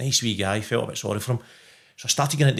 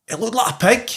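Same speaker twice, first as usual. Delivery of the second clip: son's high voice; 10 semitones higher than usual. Retold or imitated. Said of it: "And he went".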